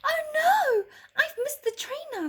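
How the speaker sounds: put-on high, girlish dismay; a little girl let down; sad girl voice. A narrator's reading. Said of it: "said Lottie sadly".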